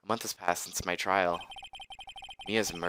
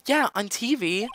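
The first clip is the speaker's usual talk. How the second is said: put-on Yeah, on T V in a much higher girl voice; Maya voice; 13 semitones higher than usual. Player voices Maya's line, much higher. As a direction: say it high like Maya. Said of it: "repeat in own voice".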